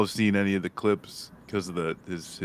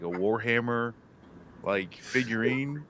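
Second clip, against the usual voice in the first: ordinary talk, nothing marked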